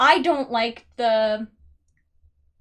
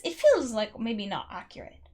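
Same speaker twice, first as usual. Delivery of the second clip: normal talking voice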